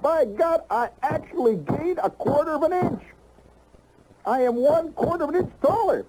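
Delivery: high, thrilled exclamation; excited. Acted. Man thrilled about his new height, high excited voice. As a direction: crow it like a small triumph.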